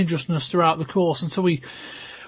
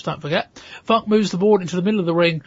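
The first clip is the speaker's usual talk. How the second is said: normal talking voice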